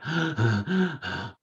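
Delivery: breathless gasp. Retold or imitated. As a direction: gasp for air. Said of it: "really gasping".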